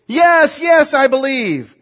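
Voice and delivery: high, loud desperate yes; eager shout. Acted. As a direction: shout your answer up in a panic. Desperate man answers God.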